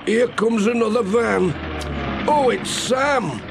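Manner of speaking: put-on high, worried old farmer fussing over his hens; fretful old farmer. Old farmer to Pat, fretting over his hens, high and worried. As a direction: fuss and fret like a put-upon farmer. Alf frets about traffic past farm.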